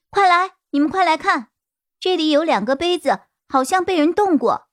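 high, urgent call to come look; excited calling out. Acted. Character calls others to kitchen.

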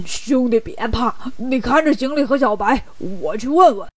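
oafish pig-man voice, reassuring; self-assured big brother in character; Bajie, confident. Acted. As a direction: take charge in a goofy put-on voice.

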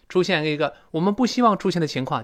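ordinary talk, nothing marked

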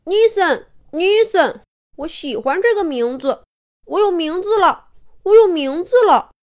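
put-on high, thrilled childlike cheering; overjoyed, high-pitched delight; happy little bear. A narrator's reading. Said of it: "小熊高兴地拍着爪爪说".

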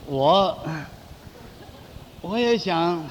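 halting, slow, with long pauses; hesitant. Acted. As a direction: ask shyly, hemming and hawing. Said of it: "你别吞吞吐吐的". (吞吞吐吐地说)

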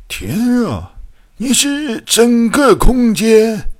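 high, drawn-out exclamation of amazement; astonished cry. A narrator's reading. Eccentric doctor learning what the being is, astonished cry. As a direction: cry out in disbelief, stretching the words. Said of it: "怪博士惊叫起来".

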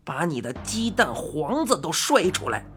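put-on odd old man holding the upper hand; gruff bargaining old man.

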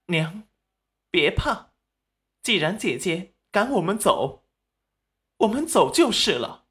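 wronged daughter comforting mother. A narrator's reading. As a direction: play the hurt, submissive younger sister.

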